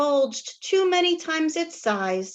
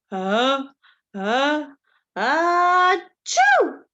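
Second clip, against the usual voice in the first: building sneeze; 7 dB louder, much slower than usual. Acted. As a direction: ham up the bear's big sneeze.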